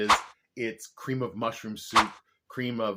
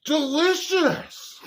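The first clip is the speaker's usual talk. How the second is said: high, slow hammed-up delight; overacted delight; 6 dB louder, much slower than usual. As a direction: oversell the first bite. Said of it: "I'm a really good actor".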